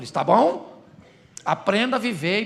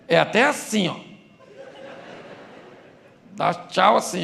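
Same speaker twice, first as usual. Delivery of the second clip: usual speaking voice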